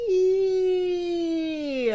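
very high, slow, squeaky ee sound. Shown as a demonstration. Teacher showing kids the letter sound, high squeaky ee. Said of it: "make her squeaky E sound".

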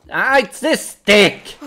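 loud, high, drawn-out complaint; frustrated outburst. Real, not acted. Player blaming the control stick, frustrated outburst. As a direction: burst out slowly and loudly.

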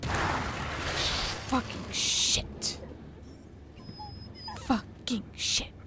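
startled cursing. Real, not acted. Startled by a jump scare.

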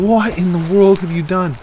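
high, loud disbelief; astonished outcry. Real, not acted. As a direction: demand an explanation in amazement. Reacts to someone's change in game.

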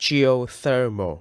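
"geothermal" slowly, with THER stressed. Shown as a demonstration. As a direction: draw out "geothermal," leaning on its third syllable, THER. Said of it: "Các bạn nhấn vào vần ther".

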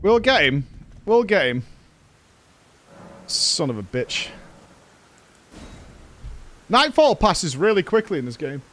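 usual speaking voice

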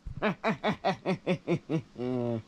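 deep, soft gloating cackle; low villain laugh. Acted. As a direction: chuckle darkly over your victory.

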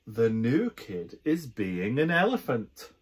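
plain reading voice

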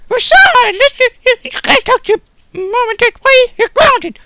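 very high, loud mock mother; squeaky mom voice. Mother grounding her son, very high loud mock scolding. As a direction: screech it like a shrill mom grounding him.

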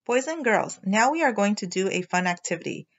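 ordinary delivery

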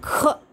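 க pushed out firmly as aspirated kha; pressed, resonant க. Shown as a demonstration. Teacher reads the pronunciation rule, க as forceful kha. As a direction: stress க with a burst of air. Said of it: "அழுத்த அதிர் ஒலியாக".

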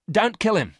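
high, loud, urgent plea; sudden outburst. A narrator's reading. Miranda stopping Garth from shooting Varon, sudden cry. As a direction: burst in to stop it. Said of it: "said Miranda suddenly".

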